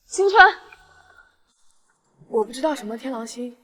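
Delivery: ordinary talk, nothing marked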